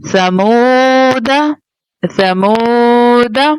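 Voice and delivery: ला आऊं तुम drawn out slowly, syllable by syllable; slow Quran recitation. Shown as a demonstration. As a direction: recite each syllable slowly with long vowels. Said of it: "तो ये क्या बन जाएगा".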